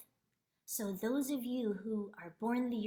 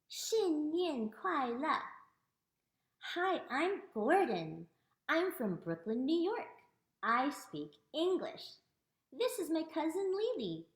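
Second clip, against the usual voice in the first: animated, singsong picture-book reading; reading to children; 5 semitones higher than usual. Acted. Reads her picture book aloud to children.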